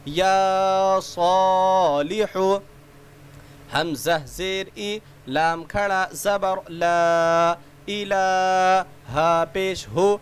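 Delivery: slow, drawn-out chanted letter recitation; sing-song spelling drill. Shown as a demonstration. Teacher spelling letters aloud for children, slow sing-song chant. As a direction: chant each letter and its vowel for young learners.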